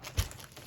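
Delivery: soft, sharp intake of breath; stunned gasp. Real, not acted. Crafter alone on finding an unexpected item, stunned gasp. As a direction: gasp at a real surprise. Discovers a surprise among items.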